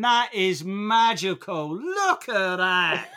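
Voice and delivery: put-on slow, high little Cockney girl; child's Cockney voice. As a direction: imitate a small child in Cockney, slowly. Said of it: "snow and going".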